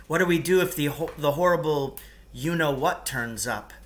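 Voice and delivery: usual speaking voice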